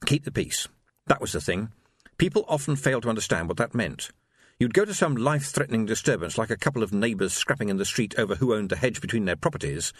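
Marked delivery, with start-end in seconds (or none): none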